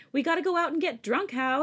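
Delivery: loud excited yell; yelling over the phone. Acted. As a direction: shout it down the phone. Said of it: "he yelled over the phone".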